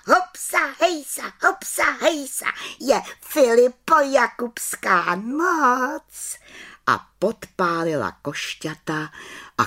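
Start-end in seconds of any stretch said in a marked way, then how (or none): 0.1-6.4 very high, whooping chant; gleeful witch's shout; jubilant little witch. Acted. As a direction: cheer it high, stretching the last line. Witch cheers as fire starts.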